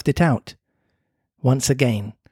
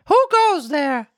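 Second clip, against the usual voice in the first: high, slow called-out question; shouting; 13 semitones higher, slower than usual. Acted. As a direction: call into the dark. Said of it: "Once or twice he shouted".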